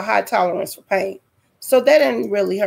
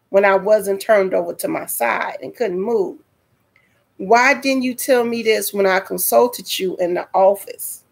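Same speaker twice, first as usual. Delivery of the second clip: normal talking voice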